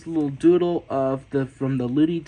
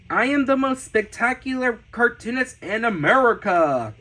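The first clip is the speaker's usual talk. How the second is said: proud, higher announcer-like proclamation; grand boast; 4 semitones higher, 3 dB louder than usual. Pretend. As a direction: proclaim it big, punching the final word. Reads own boast from sketchbook, twice.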